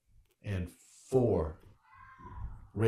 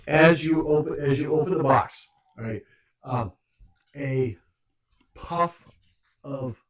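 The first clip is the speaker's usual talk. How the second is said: game master narration; 5 semitones higher, 9 dB louder than usual. Acted. GM describes trap springing.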